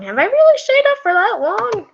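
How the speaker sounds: very high-pitched amazed question; incredulous. Real, not acted. Reader realises they stayed up late, incredulous. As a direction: squeak it in disbelief.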